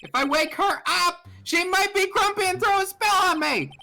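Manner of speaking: loud, high comic yelling; mock-oblivious shouting. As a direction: shout it as if nobody were sleeping. Loudly reading beside sleeping medium, as joke.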